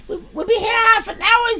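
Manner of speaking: ordinary delivery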